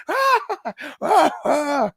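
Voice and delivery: high squealing mock scream; schoolgirl scream. Mimicking Jerry Lawler's high schoolgirl scream. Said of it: "he screamed like a little schoolgirl".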